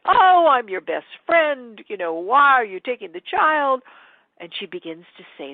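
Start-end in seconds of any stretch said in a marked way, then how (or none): 0.1-3.8 Goblin King. Retold or imitated. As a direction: coax her like a sly charmer. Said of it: "Goblin King comes and says".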